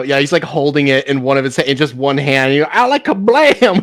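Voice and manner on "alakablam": higher, slow, goofy "Alakablam"; silly drawn-out gunshot word. Retold or imitated. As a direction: savour the silly word slowly. Quoting the video line.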